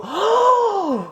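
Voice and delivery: very high, slow drawn-out cry of disbelief; floored by the twist; stunned disbelief. Real, not acted. Reacting to the fruit's origin, stunned.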